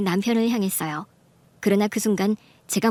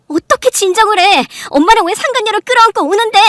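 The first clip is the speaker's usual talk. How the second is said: high, very loud, speeding up; furious outburst; 11 semitones higher, 10 dB louder than usual. Acted. Daughter to mother hugging the mistress, furious. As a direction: explode at being told to calm down.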